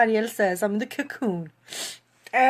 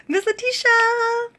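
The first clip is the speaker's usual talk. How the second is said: high, loud, drawn-out happy call; excited greeting; 13 semitones higher, 5 dB louder than usual. Real, not acted. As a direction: sing out the name in delight. Greets coach who joins live.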